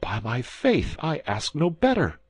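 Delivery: high, eager exclamation; eager cry. A narrator's reading. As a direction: cry it out with relief. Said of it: "cried Bonacieux".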